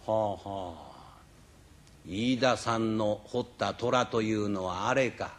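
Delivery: a calm master sizing up a rival's work; old master appraising. Acted. Jingoro eyeing the tiger. (名人が静かに値踏みする低い声)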